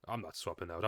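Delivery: usual speaking voice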